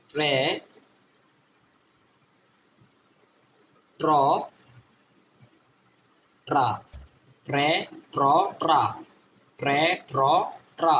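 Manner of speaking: ট্রে, ট্র, ট্রা with T and R blended; phonics drill. Shown as a demonstration. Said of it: "টি এর উচ্চারণ কি হবে?".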